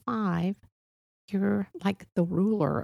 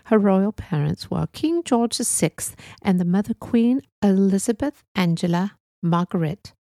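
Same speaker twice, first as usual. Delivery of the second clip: a put-on British accent, an announcement of mourning; a British accent in the manner of a BBC obituary read; 4 dB louder than usual. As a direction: read the royal news in a stately British accent, quicker at the start. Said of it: "uh, British accent in honor of the Queen".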